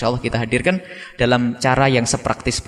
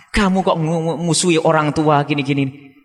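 ordinary delivery

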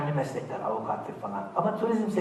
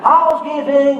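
higher, louder call with a laugh; laughing maid calling at the door; laughing sing-song hotel call; housekeeping call; 8 semitones higher, 7 dB louder, slower than usual. Retold or imitated.

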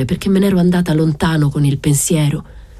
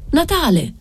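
high called name; calling out; 9 semitones higher than usual. A narrator's reading. Wife calling to her husband on the quay, high call.

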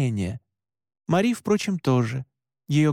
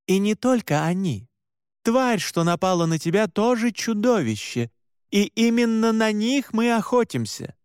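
higher-pitched, earnest woman's voice; lightly voiced Mora, insistent; 7 semitones higher than usual. Mora convincing shaken Alan, earnest and higher. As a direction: explain firmly in a raised pitch.

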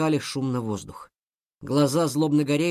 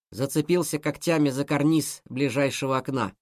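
ordinary delivery

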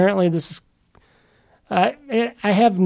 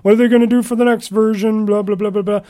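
mocking other people; 5 semitones higher than usual. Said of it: "a lot of people say".

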